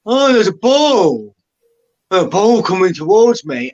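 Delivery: slow, dopey goalkeeper voice; mocking the keeper. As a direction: play the keeper as dim and slow to react. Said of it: "He does this".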